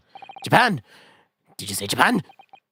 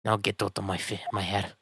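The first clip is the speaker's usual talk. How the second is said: put-on a soft, dismissive Scottish accent; 4 dB quieter than usual. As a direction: brush off the suspicious foreigners in Scottish. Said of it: "Oh, you're Scottish".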